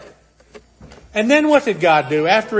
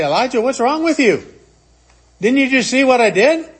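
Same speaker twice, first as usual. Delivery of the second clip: a hint of an exasperated scold, higher; mock scolding God; 6 semitones higher than usual. Imagined God scolding Elijah, mildly exasperated and higher. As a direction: rebuke him as God never did.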